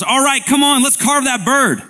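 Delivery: loud, high, hearty call to the party; excited shout across the room; neighbor's yell. Acted. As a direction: holler to rally the guests. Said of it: "your neighbor yells".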